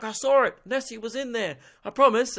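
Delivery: high-pitched, insistent witness voice; frantic eyewitness. Acted. Witness insisting Nessie was there, frantic and high. Acts out disbelieved monster witness.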